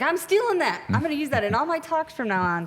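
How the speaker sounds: high-pitched imitation of an excited general; retold delight.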